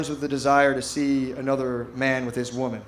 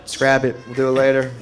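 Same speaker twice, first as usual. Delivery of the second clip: stuffed, giving up; 3 semitones lower than usual. Retold or imitated. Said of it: "going like".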